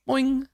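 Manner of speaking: high, springy boing; playful high-pitched boing; crossbow sound effect. Pretend. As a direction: imitate a crossbow bolt twanging off. Imitates the crossbow firing.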